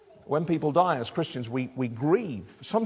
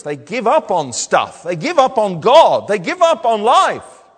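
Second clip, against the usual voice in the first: each "They give up" louder, "God" and "life" ringing out; rising preaching build; 4 semitones higher, 9 dB louder than usual. Acted. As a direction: build the three lines to a forceful peak.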